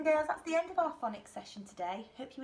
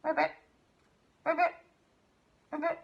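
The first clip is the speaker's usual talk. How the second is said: quick, raised-pitch mock ribbits; frog croak imitation; 6 semitones higher, 7 dB louder, faster than usual. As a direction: croak like a frog in short separate bursts. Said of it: "Next sound".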